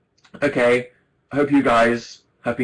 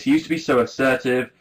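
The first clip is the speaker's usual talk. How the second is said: normal talking voice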